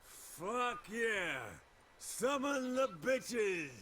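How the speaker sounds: high, slow triumphant yell; drunk cheering. Acted. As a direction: whoop it up like a drunk.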